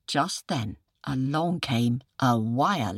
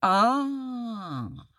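jackal's feigned insight; 4 semitones higher, slower than usual. Acted. Jackal pretends to understand.

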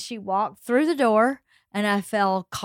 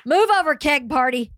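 lightly voiced rowdy mom, higher pitched; party-crashing mom; 5 semitones higher than usual. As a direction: announce Mom's arrival at the party, playfully. Imagined party-crashing mom announcing herself.

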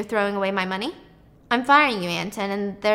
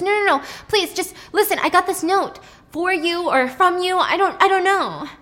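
pleading; 8 semitones higher, 5 dB louder than usual. Retold or imitated. Nick begs her to listen.

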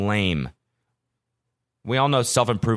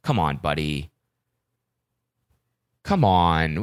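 disappointed; 5 semitones lower, slower than usual. Retold or imitated. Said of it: "I was like".

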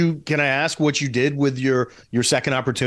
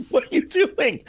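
exasperated director; 12 semitones higher than usual. Pretend. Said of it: "It'll be like".

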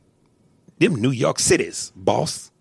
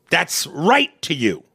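ordinary delivery

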